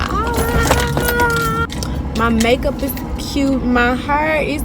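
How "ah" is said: sudden high squeal of delight; excited squeal. Real, not acted. Admiring her makeup on camera, squealing. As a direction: squeal at your own reflection.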